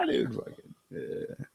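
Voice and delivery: ordinary talk, nothing marked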